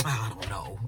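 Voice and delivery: low, soft mock reply; lightly acted low man's voice; dismissive man's voice.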